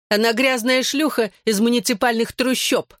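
high, malicious jeer; spiteful, ringing voice. A narrator's reading. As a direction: fling the insult in a ringing voice. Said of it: "злым звонким голосом произнесла Белла". (злым звонким голосом)

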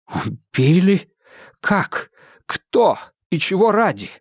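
high-pitched, stunned disbelief; aghast, broken into startled bursts; shocked questions. A narrator's reading. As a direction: fire out separate questions in astonishment, voice jumping up. Adams stunned by news of murder.

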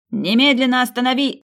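loud high command; urgent shout. Acted. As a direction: scream the order. Someone shouts to stop.

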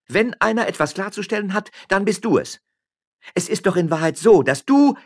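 high, loud, rising tirade; angry accusation. Acted. Miller's wife turning on her husband, angrily accusing. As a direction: build the accusation, then break off.

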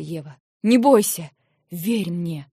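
loud, firm, reassuring urging; urgent reassurance. Acted. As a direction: hold her calm while insisting. Man reassures struggling woman.

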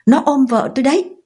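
high shout over his mother; shouting back. Acted. Son shouting back at his angry mother, defiant high shout. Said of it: "quát lớn hơn bà".

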